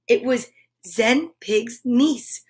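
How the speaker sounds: ordinary delivery, nothing marked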